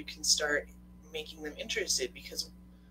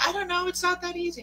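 very high, louder mock protest; exasperated wife; 5 dB louder, faster than usual. Wife answering husband about dinner, high-pitched mock helplessness. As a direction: imitate a wife squealing her indecision. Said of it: "And you're like".

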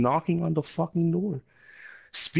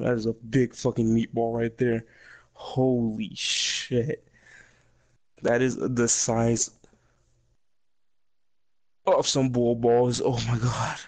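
ordinary talk

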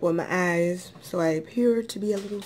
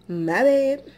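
louder, emphatic apology; 7 semitones higher, 5 dB louder than usual. Real, not acted. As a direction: say it out loud, with emphasis. Apologizing for loud chewing.

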